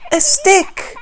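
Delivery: very high, loud excited cry; girl cries out; delighted girl. Acted. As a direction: squeal with joy at the find. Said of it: "cries the girl".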